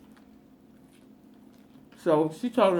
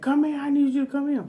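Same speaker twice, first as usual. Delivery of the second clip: urgent call; 9 semitones higher, faster than usual. Retold or imitated. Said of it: "in their native language".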